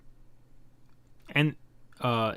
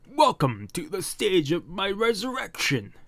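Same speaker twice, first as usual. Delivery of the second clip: high, swelling villain proclamation; grandiose monster king; 7 semitones higher than usual. Acted. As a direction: welcome him with theatrical grandeur. Monster king's grand welcome.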